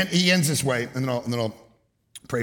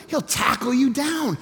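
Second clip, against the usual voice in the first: normal talking voice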